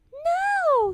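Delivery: very high, drawn-out no; a stubborn child's wail of refusal; little princess. A narrator's reading. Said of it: "said the little princess".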